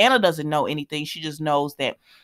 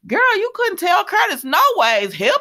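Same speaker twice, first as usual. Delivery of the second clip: sassy high exclamation; 12 semitones higher, 4 dB louder than usual. Retold or imitated. Retelling her sassy remark at TV.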